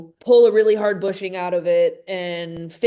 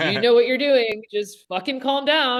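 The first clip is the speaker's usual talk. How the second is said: higher, emphatic retold reassurance; friend insisting calm; 5 semitones higher, 3 dB louder than usual. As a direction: imitate a friend urging you to settle down. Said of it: "he was just like".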